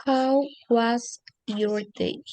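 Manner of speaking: very slow, careful English model; slow model phrase. Shown as a demonstration. Teacher leading students to repeat slowly. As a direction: stretch it out for the class to repeat. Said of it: "pueden repetir después de mí".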